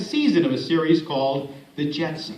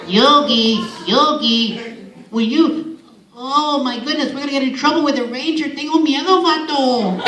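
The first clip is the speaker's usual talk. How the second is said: high, worried little cartoon sidekick; Boo Boo; 6 semitones higher than usual. Little friend warns Yogi of trouble, high anxious cartoon voice. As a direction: fret in a high little bear voice, speeding up at the ranger. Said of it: "Boo Boo would always say".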